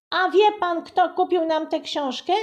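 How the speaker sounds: put-on high, loud, cunning child; crafty girl quizzing the author; sly question. A narrator's reading. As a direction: ask like she's setting a trap. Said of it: "zapytała przebiegle Buba".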